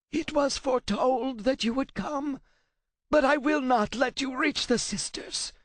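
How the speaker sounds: high rasping voice, defiant through fear; a scared scholar standing his ground; frightened translator. A narrator's reading. Translator warns Kratos off the sisters, high frightened rasp.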